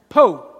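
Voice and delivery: "Po" said loud, no R, southern style. Shown as a demonstration. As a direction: drop the R and say "Po" like in southern Alabama. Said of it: "I lived in the southern Alabama".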